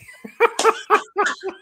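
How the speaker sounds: loud high burst of laughter; real delighted laugh. Real, not acted. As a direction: crack up loudly. Laughing at intruder.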